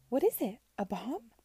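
high, loud puzzled questioning; perplexed. A narrator's reading. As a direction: ask in utter bewilderment. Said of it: "Clara was utterly perplexed".

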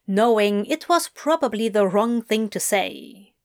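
plain reading voice

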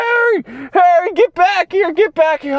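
calling the dog. Retold or imitated. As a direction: yell for the dog to come back. Calling the escaped dog back.

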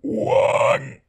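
Juan said on an inhale, breathy; talking while inhaling. Shown as a demonstration. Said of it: "Yo sé decir Juan".

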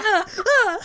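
high laughing yelps from a poked bully; bully yelping. Retold or imitated. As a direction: squeal as if poked, laughing through it. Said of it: "he's like".

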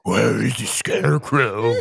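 slow, loud Batman voice; Batman impression. Podcaster warming up before the show, loud slow Batman impression. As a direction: drag it out like a loud, put-on Batman. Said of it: "I was doing a Batman impression".

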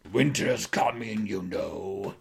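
slow, ominous put-on voice; Game of Thrones line. Wife quoting a fantasy show at the furniture store, slow mock-ominous. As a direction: intone it as a drawn-out dramatic warning. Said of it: "started throwing lines, uh, from Games of Thrones".

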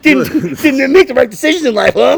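high, loud mock yell; mock shouting back. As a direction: shout him out of the house. Shouting back at delivery guy.

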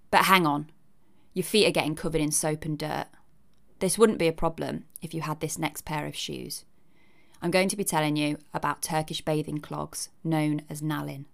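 ordinary talk

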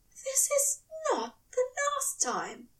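high, tender angel voice insisting; insistent angel. A narrator's reading. Angel reassuring his lover, high and insistent. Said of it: "Aziraphale insisted".

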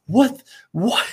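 high, incredulous yelling at a save; shouting in disbelief. Retold or imitated. To a friend, replaying his disbelief at the save. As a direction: relive the moment, pitch way up. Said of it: "I was losing my shit".

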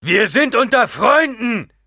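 calling out. A narrator's reading. Gurney calls to his men that they are among friends. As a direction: shout reassurance to distant men. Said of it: "rief Gurney ihnen zu".

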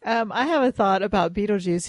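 ordinary talk

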